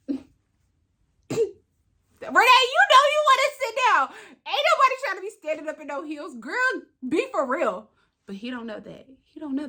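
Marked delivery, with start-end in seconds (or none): 0.1-7.9 sassy self-scolding. Retold or imitated. Woman talking herself into sitting down, sassy scolding. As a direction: tell yourself off, high and insistent.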